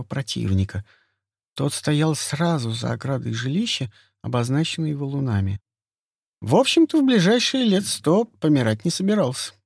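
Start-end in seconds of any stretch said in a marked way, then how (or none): none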